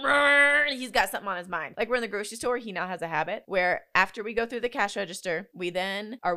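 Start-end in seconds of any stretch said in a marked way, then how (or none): 0.0-0.7 loud mock growl of a stubborn kid; toddler growl. Parent imitating her son's frustrated growl. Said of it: "He's going".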